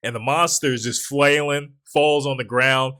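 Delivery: normal talking voice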